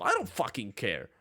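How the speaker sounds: normal talking voice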